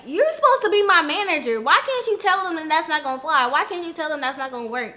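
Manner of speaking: loud, high, exasperated scolding; angry complaint. Retold or imitated. To her manager about unpaid work, indignant and loud. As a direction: demand answers from the manager.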